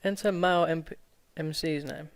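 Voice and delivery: ordinary delivery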